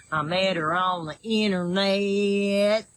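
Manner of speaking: slow, stretched Southern catchphrase; Southern drawl. Shown as a demonstration. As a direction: drag out the last word in a Southern drawl. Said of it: "my southern drawl".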